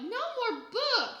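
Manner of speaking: high, loud, slow tantrum yell. A narrator's reading. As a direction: drag it out furiously. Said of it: "he yelled".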